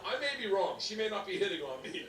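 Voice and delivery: ordinary delivery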